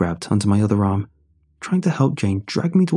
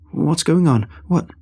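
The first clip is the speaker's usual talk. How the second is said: breathless stammer; 6 semitones higher than usual. A narrator's reading. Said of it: "I tried to stammer out a question".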